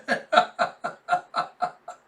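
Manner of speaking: high, breathy real laugh; amused laughter. Real, not acted. Reader laughing at poor Lloyd, breathy laughter. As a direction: laugh it out helplessly.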